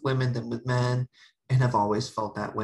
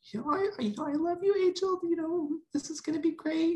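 high, sweet mocking of mom. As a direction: mimic mom cooing loving words. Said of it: "She was instantly like".

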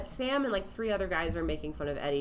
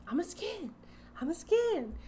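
high voice repeating the line; eager Raven; 10 semitones higher than usual. Retold or imitated. Raven joins the skins team, high eager repeat. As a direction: chirp it like an eager kid. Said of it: "Raven is like".

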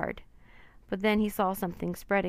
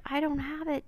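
much higher desperate shout; 8 semitones higher than usual. Acted. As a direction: shout back to Annie in desperation. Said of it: "Jack shouted".

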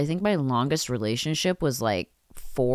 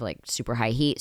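usual speaking voice

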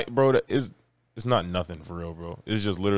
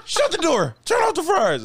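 high frantic mock panic, higher; panicked yelling. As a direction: shout it like the kitchen is overrun. Acting panicked restaurant staff.

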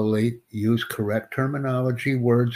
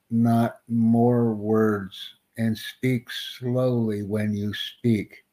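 slow, deliberate instruction with drawn-out words; much slower than usual. Real, not acted. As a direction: spell it out word by word for a learner. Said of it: "I am speaking very slowly to you".

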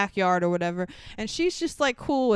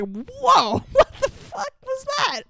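shocked whoa; 14 semitones higher, 4 dB louder, slower than usual. Retold or imitated. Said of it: "Frankie's reaction to this".

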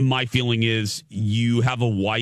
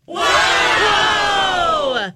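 excited shout; 5 dB louder than usual. Real, not acted. Mock amazement at ticket news.

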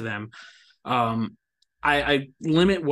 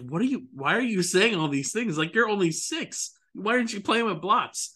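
mock disbelief at a six-year-old; higher, bemused; incredulous, higher; 7 semitones higher than usual. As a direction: raise the pitch in amazement. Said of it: "I'm like".

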